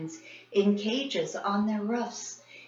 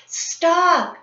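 higher, urgent shouts; still shouting; 8 semitones higher, 4 dB louder, slower than usual. A narrator's reading. Kids racing up the stairs shouting to grandfather, urgent. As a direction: keep yelling up the stairs. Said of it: "still shouting".

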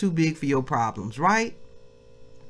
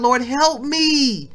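higher, louder prayer for help; pleading prayer voiced by preacher; 5 semitones higher, 4 dB louder than usual. Preacher modelling a prayer for the family, pleading and loud. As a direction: cry out to the Lord for help.

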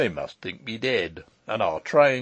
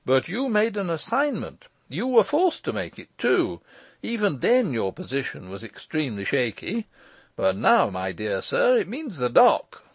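insistent argument in a raised, louder voice; arguing lawyer; 7 semitones higher, 4 dB louder than usual. Acted. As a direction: press the case hard, pitched up. Lawyer arguing with his uncle.

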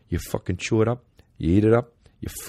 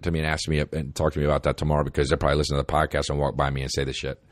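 ordinary delivery

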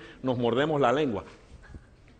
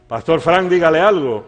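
louder, insistent retold demand; raised tone; 3 semitones higher, 11 dB louder than usual. Lady pressing the silent pastor again, raised insistent tone. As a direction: imitate an impatient demand, voice raised. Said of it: "en un tono más subido".

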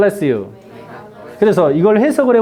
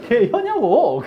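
higher, laughing Chungcheong question; Chungcheong dialect; 4 semitones higher, slower than usual. Retold or imitated. Imitating acquaintance repeating to teacher, laughing Chungcheong dialect. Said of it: "충청도 말 굉장히 빨라요".

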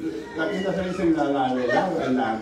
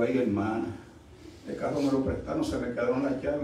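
subdued explanation; 3 semitones lower, 8 dB quieter than usual. Retold or imitated. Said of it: "Yo le digo".

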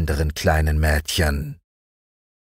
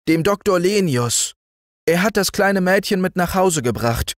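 put-on higher, eager boy's voice, at times quick; earnest boy messenger; 11 semitones higher, faster than usual. Boy bringing good news from his doctor father. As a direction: reassure the family, bright and eager.

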